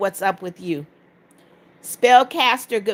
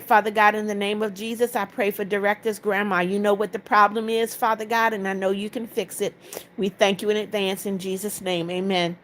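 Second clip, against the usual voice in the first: prayer; 2 semitones lower, 5 dB quieter than usual. Acted. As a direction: pray it quietly over the viewer. Prays for a viewer's grandma.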